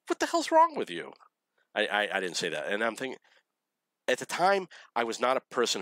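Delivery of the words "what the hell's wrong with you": very high, exasperated mock outburst; incredulous retort. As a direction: throw it out in high-pitched disbelief. Imagined retort to crying girl.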